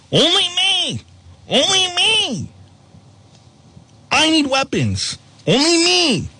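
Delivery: high, whiny, childish mock voice. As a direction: whine it like a spoiled kid. Mock quote of Zelensky begging.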